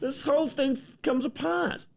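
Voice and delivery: very high, slow squeal of alarm; hammed-up high-pitched panic; mock panic. Real, not acted. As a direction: shriek as it falls apart. Toy keeps falling apart.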